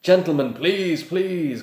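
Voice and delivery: high, loud pleading cry. A narrator's reading. Shop assistants plead with the brawlers, crying out. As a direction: beg them to stop, voice climbing. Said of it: "cried the assistants".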